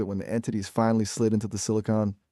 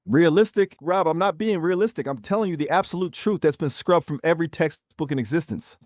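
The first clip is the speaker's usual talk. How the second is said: indignant, higher-pitched pushback; with attitude; 7 semitones higher than usual. Real, not acted. AI guest objecting to being called realistic, with attitude. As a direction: push back sharply, pitched up in offence. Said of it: "you were saying that with some attitude".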